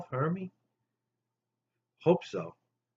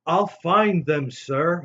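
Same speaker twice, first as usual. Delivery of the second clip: slow and louder, firm promise; determined vow; 5 semitones higher, 8 dB louder, slower than usual. Retold or imitated. Rudolph vows to Santa, slow firm loud promise. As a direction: answer Santa with brave resolve.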